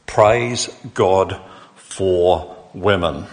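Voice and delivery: very slow, evenly spaced words at normal volume; slow, measured statement. Real, not acted. Preacher on Mother's Day summing up his praise, slow and deliberate. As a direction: let each word stand on its own. Repeats the cry as own statement.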